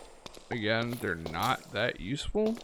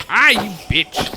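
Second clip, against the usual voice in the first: sudden loud outburst, slow and higher; startled yell; 9 semitones higher, 12 dB louder, slower than usual. Real, not acted. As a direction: yell out in surprise. Startled by a mob attack.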